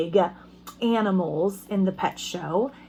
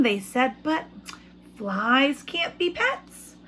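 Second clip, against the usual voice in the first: put-on reading to children; 3 semitones higher, slower than usual. Teacher reads picture book aloud.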